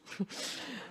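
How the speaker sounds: a sharp unvoiced hiss like a cat. Cats warning each other off, sharp hiss. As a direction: hiss through the teeth like an annoyed cat. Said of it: "they just go".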